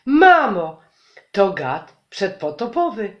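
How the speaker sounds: plain reading voice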